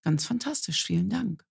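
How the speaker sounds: overly calm thank-you to a rude neighbour. Retold or imitated. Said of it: "ich bin ganz ruhig geblieben".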